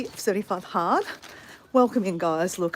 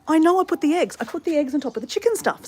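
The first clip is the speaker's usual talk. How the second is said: higher, insistent calling out; re-enacted yelling; 7 semitones higher, 4 dB louder, faster than usual. Yelling back from upstairs about the eggs, re-enacted yell. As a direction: act out shouting back, exasperated. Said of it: "So I'm yelling this out from upstairs".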